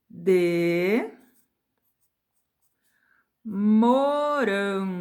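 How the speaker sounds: palavra por palavra, bem devagar; ditado lento. Shown as a demonstration. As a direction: dita cada palavra enquanto escreve. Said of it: "Eu vou escrever assim". (professora ditando "Eu comi uma bala de morango" devagar)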